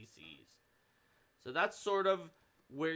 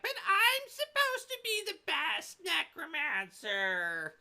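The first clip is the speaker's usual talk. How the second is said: much higher, slow whiny wizard voice; necromancer voice; 6 dB louder, slower than usual. Retold or imitated. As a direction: whine it like a spoiled necromancer. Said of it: "the necromancer voice".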